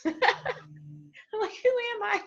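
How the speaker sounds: high-pitched, laughing through the words; real giggly, high voice; laughing while talking. Real, not acted. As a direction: crack up at yourself as you say it. Laughing at her own bossy advice.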